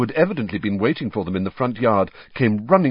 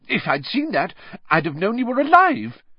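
upset mother; 12 semitones higher, 3 dB louder than usual. Acted.

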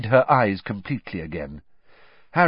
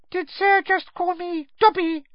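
very high, tiny quivering squeak; a curious little elf piping up; squeaky house elf; 4 dB louder than usual. A narrator's reading. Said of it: "a teeny quivering squeak of a voice".

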